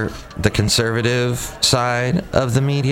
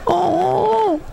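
mock sob. Mock sob over married couples.

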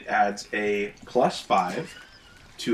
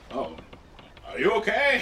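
concerned NPC; 9 semitones higher than usual. Acted. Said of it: "is like".

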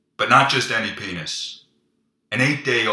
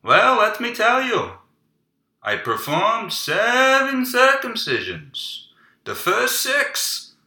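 moil dinner chat; 11 semitones higher, 5 dB louder than usual. Pretend. Moil tells his wife about his day at dinner, high loud hammed-up voice. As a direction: ham up a gossiping moil at dinner.

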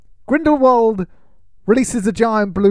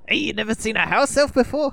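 put-on New York accent; 5 semitones higher than usual. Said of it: "trying to do the New York".

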